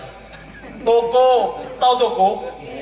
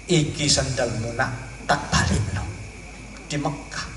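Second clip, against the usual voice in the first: gentle elder; 4 semitones lower, 7 dB quieter than usual. Retold or imitated. Elder Mbah Hamid speaks gently.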